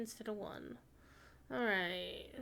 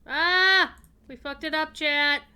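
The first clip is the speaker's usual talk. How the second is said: frustrated shout; 5 semitones higher, 13 dB louder than usual. Real, not acted. Game mistake, yelling at chat.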